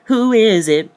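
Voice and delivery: high loud challenge; mock demanding question. Confronting the alien man, high loud challenge. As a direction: call it out sharply. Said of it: "Look at him like".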